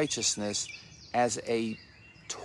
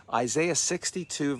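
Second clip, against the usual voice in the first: ordinary talk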